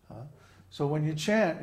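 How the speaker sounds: ordinary talk, nothing marked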